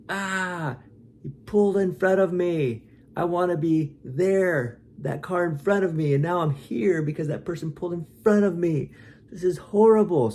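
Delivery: high, loud outraged rant at being cut off; angry driver. Acted. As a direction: play the furious driver who got cut off.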